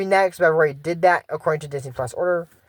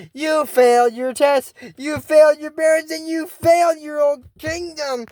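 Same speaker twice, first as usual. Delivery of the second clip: mock accusing villain; 10 semitones higher, slower than usual. Acted villain accusing Lonnie.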